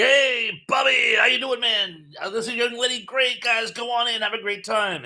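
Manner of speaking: hammed-up buddy welcome at the door; buddy at the cinema. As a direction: play the pal waving you through. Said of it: "you were like".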